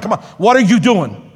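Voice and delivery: ordinary delivery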